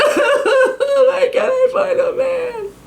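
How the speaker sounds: very high, slow, laughing-crying squeal; drunk party girl. Pretend. Same party girl, later that night.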